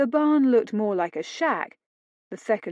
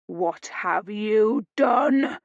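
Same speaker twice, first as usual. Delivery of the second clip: thundering; much slower than usual. A narrator's reading. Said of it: "Paul thundered at the girl".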